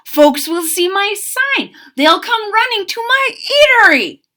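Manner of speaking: high, bright anticipation; picture crowds running in; eager. A narrator's reading. Cook dreaming of customers, high and eager.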